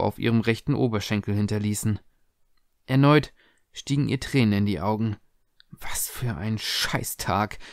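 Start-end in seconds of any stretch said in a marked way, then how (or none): 5.7-7.6 slow, softer, whispered curse; a whispered inner grumble; whispered thought. A narrator's reading. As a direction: breathe it out to herself. Tessa's inner thought, alone.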